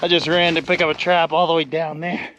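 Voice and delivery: breathless, talking between quick breaths; winded after running, still puffing. Real, not acted. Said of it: "Sorry, I'm a little out of breath".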